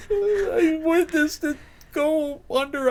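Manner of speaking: a high laughing voice. Acted. Character laughs through line.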